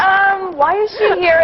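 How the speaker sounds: high, slow, judging aside; puzzled onlookers. Acted. Club crowd judging her, high slow disbelief. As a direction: draw it out as a disapproving onlooker. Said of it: "They're like".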